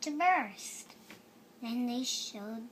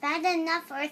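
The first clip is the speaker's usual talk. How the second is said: loud, higher witch voice; 4 semitones higher, 8 dB louder, faster than usual. Acted. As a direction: belt it like a witch. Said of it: "what does she sound like? Go ahead, do it".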